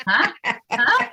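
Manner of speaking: high-pitched, puzzled double take; mock bafflement. Brit retelling her confusion at the accent advice, mock baffled. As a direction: act out being baffled, voice leaping up.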